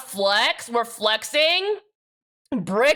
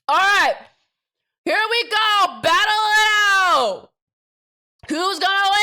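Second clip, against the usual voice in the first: higher, loud hype at the race; excited shouting; 8 semitones higher, 5 dB louder than usual. Real, not acted. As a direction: yell it like a race fan. Shouting during the race start.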